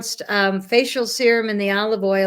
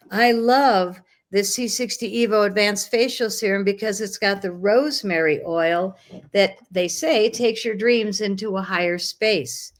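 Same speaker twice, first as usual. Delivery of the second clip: usual speaking voice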